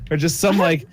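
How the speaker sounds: ordinary talk, nothing marked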